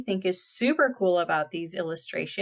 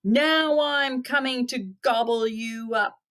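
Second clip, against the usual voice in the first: troll roar; 5 semitones higher than usual. Acted. Troll threatening the big goat, roaring it out. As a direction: roar the threat in long stretched words. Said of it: "roared the troll".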